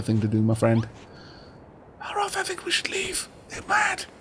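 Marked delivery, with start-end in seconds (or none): 2.0-4.1 high breathy whisper to Maroth. Acted. Player whispering to companion Maroth, urging to leave. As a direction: hiss it under your breath.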